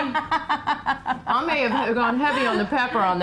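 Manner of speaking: laughing. Real, not acted. Laughing about too much pepper.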